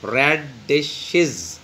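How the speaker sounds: रेडिशेस slowly split as rad, di, shes; syllable by syllable. Shown as a demonstration. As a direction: stretch रेडिशेस into three separate syllables. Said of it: "इसमें भी तीन syllable होंगे". (रेडिशेस کو تین حصوں میں آہستہ توڑ کر بولیں)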